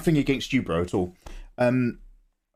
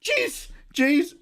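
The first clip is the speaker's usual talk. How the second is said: much higher, nervous cartoon slacker voice; Shaggy. Streamer tries Shaggy when chat asks, much higher. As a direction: squeak it out like a jittery cartoon hippie. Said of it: "Do Shaggy".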